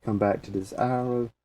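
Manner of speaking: ordinary talk, nothing marked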